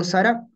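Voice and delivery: Sara with its s sounded; as written. Shown as a demonstration. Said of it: "tal como está escrito".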